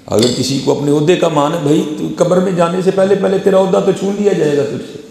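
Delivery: high, forceful pulpit warning; fiery preaching. Acted. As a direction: thunder it at the congregation.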